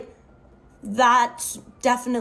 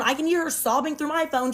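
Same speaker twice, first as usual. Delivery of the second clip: usual speaking voice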